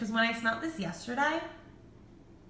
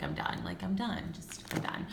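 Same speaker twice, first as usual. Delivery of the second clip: quiet surrender; 7 dB quieter than usual. Retold or imitated. Overwhelmed by the colors.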